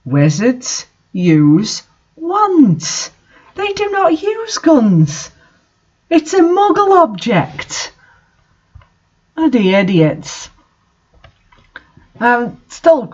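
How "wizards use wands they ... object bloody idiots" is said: exasperated. Real, not acted. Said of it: "Guns, for God's sake".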